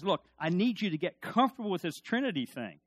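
ordinary talk, nothing marked

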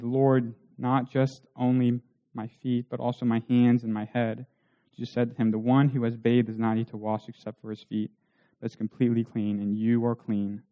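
measured, soft reading of the Gospel; scripture reading. Acted. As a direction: read the passage like a quiet recitation. Reads scripture passage aloud.

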